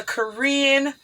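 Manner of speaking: slow, careful enunciation word by word; measured slow delivery, spacing out each word; deliberately slow. Real, not acted. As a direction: say it slowly to avoid tripping over it. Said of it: "I gotta say that slow".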